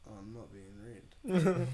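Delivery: low, slow, blunt teen imitation; monotone teenage brother. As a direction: drag it out low in a deep monotone.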